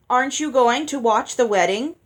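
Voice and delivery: put-on loud, eager childish question; a pushy child nagging. A narrator's reading. Olive knocking Ella's arm, loud and childish.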